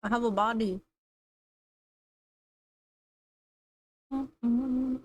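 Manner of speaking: talking while chewing food; words round a bite of food. Real, not acted.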